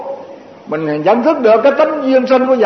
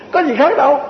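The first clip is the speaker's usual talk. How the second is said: laughing; 7 semitones higher than usual. Real, not acted. Laughing after mock curse.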